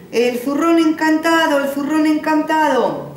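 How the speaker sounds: loud, high barker's call; crying out to a crowd. Acted. Old man draws the village crowd, loud high call. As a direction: announce it like a fairground barker. Showman calls out in square.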